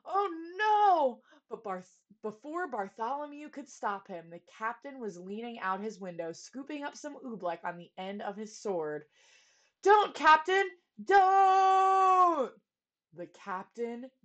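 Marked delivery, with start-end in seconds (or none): 0.0-1.2 high, loud alarmed cry; horrified cry. A narrator's reading. Captain about to eat oobleck. 9.8-12.5 high, loud drawn-out cry; desperate shout. A narrator's reading. Stopping the captain.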